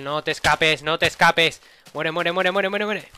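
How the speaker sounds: high loud chasing yell; excited shout. Real, not acted. Chasing enemy.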